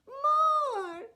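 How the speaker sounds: put-on very high loud baby demand; high loud baby cry. A narrator's reading. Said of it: "said the baby".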